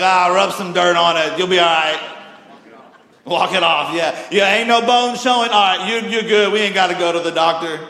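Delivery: loud, offhand dad shrugging it off; booming no-nonsense father; gruff dad. Retold or imitated. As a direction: play the tough dad telling the kid to walk it off. Dad voiced brushing off hurt kid.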